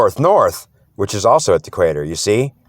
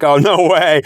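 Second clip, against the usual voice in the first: higher, slightly louder retold amazement; mock disbelief; 6 semitones higher, 4 dB louder than usual. Reacting to the Spirit's message, mock disbelief. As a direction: act out being taken aback. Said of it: "I'm like".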